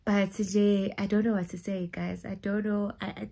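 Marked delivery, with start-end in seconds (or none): none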